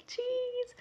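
very high, soft cheese; sing-song cheese for the camera; photo cheese. Retold or imitated.

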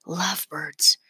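breathy hush into an ear; whisper. A narrator's reading. Mister Carp whispering gossip to Captain Rickenbacker. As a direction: confide it secretly.

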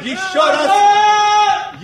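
shouted command; loud urging. Acted. Холмс подгоняет кричащих. (громкий призыв)

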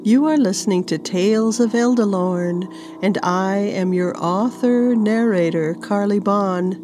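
loud, bright announcer read of the show and chapter; podcast intro. A narrator's reading. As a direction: welcome the listeners and announce the chapter.

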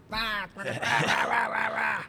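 high-pitched ranting gibberish; mock yelling. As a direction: rattle off a wordless mock tirade. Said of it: "Will you yell and scream?".

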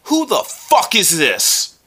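slow, high mock outrage; playing up annoyed disbelief; upset, hammed-up. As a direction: draw out the indignant question, embellished for the show. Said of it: "you sound very upset".

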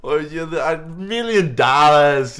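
loud, high, drawn-out mock Yiddish accent; hammy Yiddish accent; attempted Yiddish accent. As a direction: stretch it out in a badly faked Yiddish accent. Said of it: "talking in, like, a Yiddish accent".

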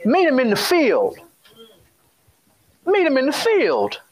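preacher's rising call; rising appeal. Real, not acted. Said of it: "repeats the call higher".

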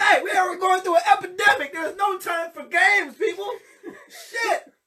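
loud high exasperated shout; shouted rant. Real, not acted. Ranting at parents wasting toilet paper, loud and high. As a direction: yell it at the whole internet. Ranting loudly about poop challenges.

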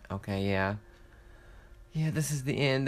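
usual speaking voice

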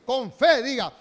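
shouting to rouse the church; loud, rising exhortation. Real, not acted. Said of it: "bien fuerte".

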